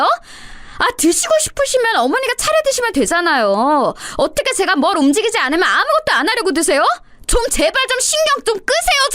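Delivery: climbing into a screaming outburst; screaming back. Acted. Daughter-in-law screaming back at mother-in-law, climbing fury. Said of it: "저도 이때 눈이 완전히 돌아서는 똑같이 소리를 질러버렸고".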